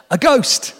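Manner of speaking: high, loud frightened cry of a ghost; a hammed-up scared shriek; mock scream. A narrator's reading. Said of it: "They, they screamed".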